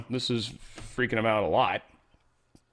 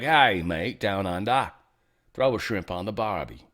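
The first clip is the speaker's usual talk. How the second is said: hammed-up Australian greeting, slightly lower; mock Aussie accent; 4 semitones lower than usual. Host playfully greeting Aussie friends from afar, mock Australian accent. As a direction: put on a cartoon Australian accent. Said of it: "grabbing onto our Aussie friends and be like".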